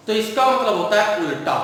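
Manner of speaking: ordinary talk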